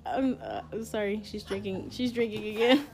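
ordinary delivery

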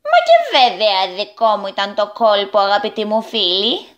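goblin voice full of pride; proud goblin. A narrator's reading. Tipoukito proudly owns the trick to his friends. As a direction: boast of the trick grandly. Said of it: "είπε με καμάρι ο Τιπουκίτο".

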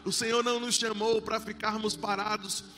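fervent, loud preaching. Acted. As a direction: preach it with full force. Preaching to the congregation.

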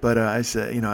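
normal talking voice

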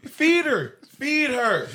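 high, loud, gleeful yell; joking shout. Real, not acted. Telling the guys he doesn't care, loud joking shout. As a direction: holler it out as a punchline.

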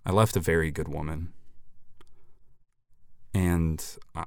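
hushed deadpan sorrow; mock somber confession. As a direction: drop into a fake-serious sad confession. Sudden sincere confession.

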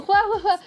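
spelled-out mock laugh. Retelling an idol laughing at jokes, imitated laugh. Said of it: "[name] también era como".

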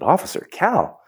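high, disbelieving repeat of the name; incredulous echo. Retold or imitated. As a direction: echo it back as if you can't believe it. Retelling disbelief at visitor's name.

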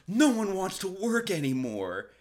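high mock-whine of a complaining boss; high sarcastic whine; mocking whiny boss. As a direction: whine it in a high put-on voice like an offended employer. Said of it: "They're immediately like".

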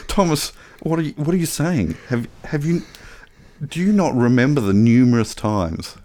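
mock disbelief. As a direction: act incredulous, stammering as the pitch jumps up. Feigned disbelief as co-host denies it.

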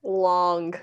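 Long said alone, heavy and drawn out; stress on long. Shown as a demonstration. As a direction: lean hard on Long. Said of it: "Let's just emphasize long".

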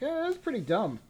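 high sneering put-down; mocking dismissal. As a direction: scoff at it. Friends mocking the movie.

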